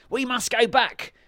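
high, loud command; baron's urgent shout. A narrator's reading. Tricked baron ordering his men back, shouting. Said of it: "he shouted".

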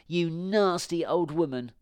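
put-on high-pitched, accusing girl's voice; indignant little girl. A narrator's reading. Little girl to the old witch, indignant and high. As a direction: scold her in a high child's voice.

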